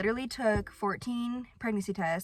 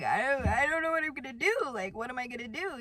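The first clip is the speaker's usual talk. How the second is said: retold crying; 4 semitones higher, faster than usual. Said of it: "and I started crying".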